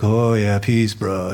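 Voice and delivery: mock a mellow bro saying peace; lightly voiced laid-back dude. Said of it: "we're not talking like that".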